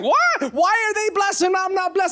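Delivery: high-pitched, hammed-up jealous protest; mock envious outcry. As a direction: cry out like an envious churchgoer. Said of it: "so envious and so jealous".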